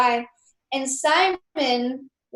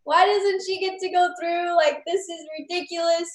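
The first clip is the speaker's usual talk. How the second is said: higher, retold frustrated rant; angry dad; 7 semitones higher than usual. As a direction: imitate the dad venting his frustration. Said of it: "he was punching the walls".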